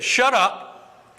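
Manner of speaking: high-pitched, abrupt put-down; acted command to be silent; silencing retort. Acted opponents silencing believers.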